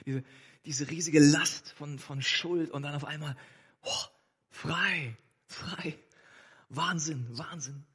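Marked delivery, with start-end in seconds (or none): 3.8-7.8 awed relief. Acted. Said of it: "Was für ein Moment".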